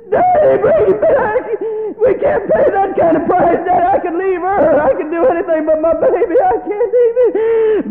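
very high, loud, sobbing shout; a mother begging for her child's life; wailing plea. Acted. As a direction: scream it through tears.

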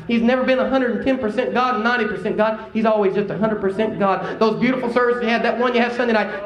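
high, driving sermon delivery; impassioned preaching. Real, not acted. Preacher telling church God is present tonight, impassioned preaching. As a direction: preach it with rising fervor, pitch held high.

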